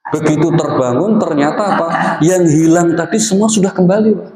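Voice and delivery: high, bright rising thrill of found luggage; overjoyed. Retold or imitated. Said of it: "Saking girangnya".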